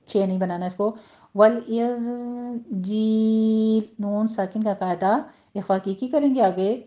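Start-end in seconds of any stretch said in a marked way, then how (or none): 1.3-3.9 وَالْإِنْجِيلَ chanted slowly with a plain j in جیل; correct pronunciation. Shown as a demonstration. Teacher modelling the word for students, slow chant. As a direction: recite وَالْإِنْجِيلَ slowly, unaspirated. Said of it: "جھیل نہیں بولنا ہے".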